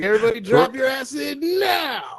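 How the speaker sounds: high, shouted party call; party host shout. Pretend.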